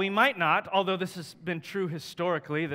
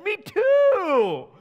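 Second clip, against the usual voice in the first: squeaky, much higher eager cry; delighted; 10 dB louder than usual. Retold or imitated. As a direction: chirp it out, thrilled to agree. Delighted at shared faith.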